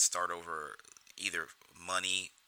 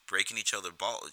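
normal talking voice

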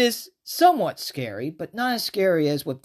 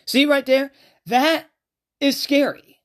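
excited; 8 semitones higher, 5 dB louder than usual. Real, not acted. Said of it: "excited point after demo".

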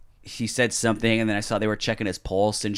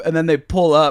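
ordinary talk, nothing marked

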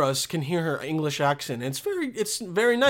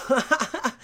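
short higher-pitched laugh; 6 semitones higher than usual. Real, not acted. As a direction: break into a brief chuckle. Laughing while looking for picture.

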